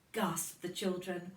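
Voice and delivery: plain reading voice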